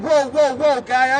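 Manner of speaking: a hint of a fake concerned officer; fake friendly cop. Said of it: "pretends to be a different police officer".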